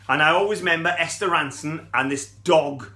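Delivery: ordinary talk, nothing marked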